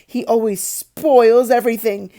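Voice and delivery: sulky pretend yell. A narrator's reading. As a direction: shout it through fake tears. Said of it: "Dudley yelled".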